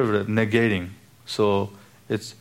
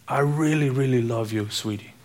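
mock sweet talk; 2 semitones higher than usual. Quoting a false lover.

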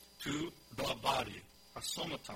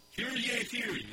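much higher, loud cry of hear ye; town crier; 10 semitones higher, 7 dB louder than usual. Retold or imitated. Preacher imitating a town crier gathering the people, loud call. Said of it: "is the town crier".

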